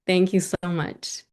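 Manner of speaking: usual speaking voice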